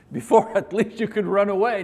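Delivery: amused laugh breaking into words. Real, not acted. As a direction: chuckle out the punchline. Laughs while joking about limp.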